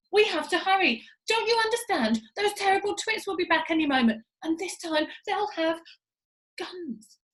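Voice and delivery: high, loud hurried urging; urgent urging. A narrator's reading. Monkey father urging his family to hurry, high and loud. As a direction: hurry everyone along, high and pressing.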